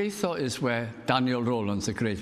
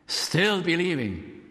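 slow, raised; 5 semitones higher, slower than usual. Acted. Climax of the point.

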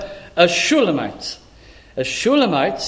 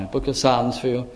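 ordinary talk

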